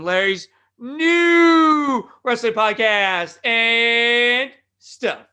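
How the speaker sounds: high, loud, stretched-out show title; shouted announcer plug. Acted. Host plugging his podcast, shouted drawn-out announcer style. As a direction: bellow it like a ring announcer, vowels held long.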